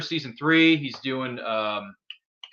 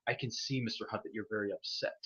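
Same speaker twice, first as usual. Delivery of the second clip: much softer, almost breathed threat; menacing villain line; 5 semitones lower, 14 dB quieter than usual. Retold or imitated. Podcaster quoting the villain's line to the hero, hushed and menacing. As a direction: lean in close and menace quietly. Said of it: "if he drops that line".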